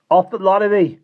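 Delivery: loud, high bark of rage; sudden furious shout; angry snap. A narrator's reading. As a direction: snap at them to clear off. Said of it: "he snapped".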